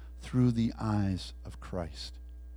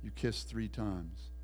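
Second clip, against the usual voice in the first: ordinary talk, nothing marked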